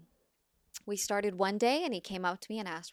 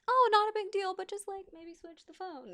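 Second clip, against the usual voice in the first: gentle sing-song reassurance, pitched way up; downplaying it; 13 semitones higher than usual. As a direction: make it sound like no big deal. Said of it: "try not to make it a big deal".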